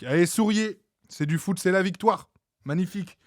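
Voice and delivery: cheerful sign-off. Real, not acted. Streamer signing off to viewers.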